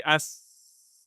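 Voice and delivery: hissed s with the tongue floating; s sound. Shown as a demonstration. Teacher demonstrating the letter sound for students. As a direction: hold a plain s, tongue not touching. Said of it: "If you say".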